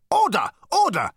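high, loud yapped calls for order; yapping. A narrator's reading. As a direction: yap officiously over the meeting. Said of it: "yapped the management consultant".

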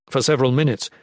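ordinary delivery

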